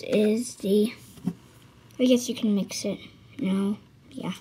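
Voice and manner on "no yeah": whisper. Real, not acted. Whispering aside.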